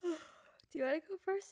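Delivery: ordinary delivery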